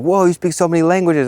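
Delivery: high, amazed mock gasp; impressed. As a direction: gush like you're amazed. Impressed locals quoted.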